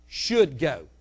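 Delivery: ordinary talk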